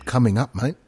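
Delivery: usual speaking voice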